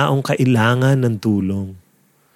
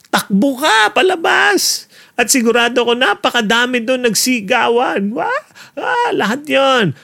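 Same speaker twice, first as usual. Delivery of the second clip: much higher, frantic, running away from the risen dead; panicked crowd; 11 semitones higher than usual. Pretend. Onlookers fleeing the man risen from the dead, mock panic. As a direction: act out the scared onlookers fleeing.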